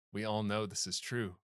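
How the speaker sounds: low, hushed solemn quote; mock-choked-up character voice; emotional. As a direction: deliver it gravely, as if moved by the story. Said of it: "I get emotional".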